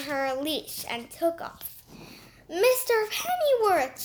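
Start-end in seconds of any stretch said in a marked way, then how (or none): 2.5-4.0 shrieked. Acted. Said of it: "shrieked her".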